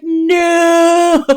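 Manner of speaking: a hammed-up groan at the ad; mock wail of dismay. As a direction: wail it like it's a disaster. Dismay at seeing the ADT ad.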